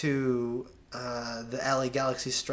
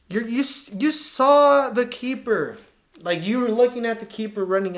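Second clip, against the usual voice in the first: exasperated; 7 semitones higher, 6 dB louder than usual. Real, not acted. Coach exasperated at a walking midfielder.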